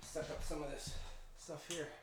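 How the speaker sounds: ordinary delivery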